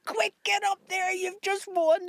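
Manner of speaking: retold urgent shout, high and slowed; high, hurried mock alarm; urgent call. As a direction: mimic a frantic runner calling them up. Someone rushing to tell them.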